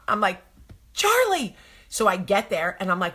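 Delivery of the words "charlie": high-pitched call of her name. Retold or imitated. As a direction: call out sharply. Mother calling daughter.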